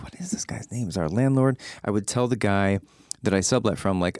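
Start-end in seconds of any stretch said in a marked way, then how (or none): 0.0-1.6 a half-voiced whisper, as if peering at names on the mailboxes; secret whisper. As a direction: hush it like you're snooping at the mailboxes. Secretly wondering about the landlord.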